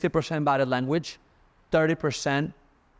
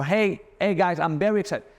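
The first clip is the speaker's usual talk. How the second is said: excited pitch; 7 semitones higher than usual. Shown as a demonstration.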